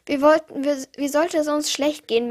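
plain reading voice

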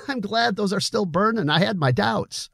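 put-on relieved young man after a laugh; Billy voiced. A narrator's reading. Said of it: "Billy laughed".

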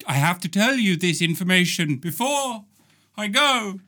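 solemn visitor from another dimension, growing urgent; lightly voiced alien messenger. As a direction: warn the people of Earth before you explode.

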